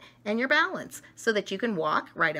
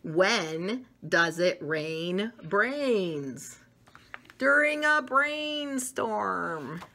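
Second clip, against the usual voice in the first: riddle; much slower than usual. Acted. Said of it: "here is a riddle".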